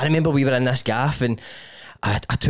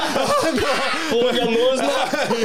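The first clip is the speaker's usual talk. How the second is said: laughing; 10 semitones higher, much slower than usual. Real, not acted. Cracking up at drug story.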